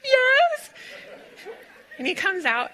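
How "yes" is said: high yes squeezed out through laughter; a teary laughing yes. Retold or imitated. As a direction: laugh out the yes, almost crying. Laughing retelling her answer.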